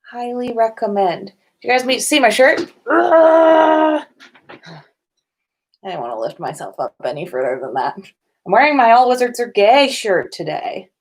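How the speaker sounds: ordinary talk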